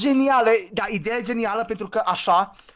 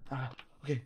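ordinary talk, nothing marked

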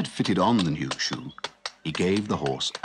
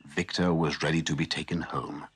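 plain reading voice

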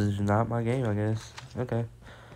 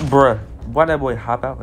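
startled outburst; 9 dB louder than usual. Real, not acted. Enemy suddenly jumps out at him.

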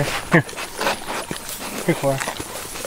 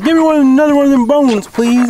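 higher, pleading dog voice put on by owner; voicing the dog; 7 semitones higher, 4 dB louder than usual. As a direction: beg for another bone like the dog would. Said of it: "He said".

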